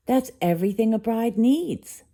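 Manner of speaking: delighted bride-to-be; exclaimed. A narrator's reading. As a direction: announce it with glee. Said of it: "Ariel exclaimed".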